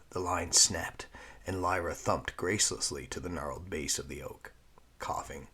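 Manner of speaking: plain reading voice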